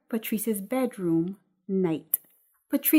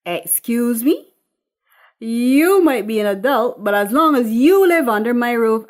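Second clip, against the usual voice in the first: stern mother scolding, much louder; Eunice; 3 semitones higher, 9 dB louder than usual. Acted. Eunice to Patrice, loud stern scolding. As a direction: lay down the law as the mother.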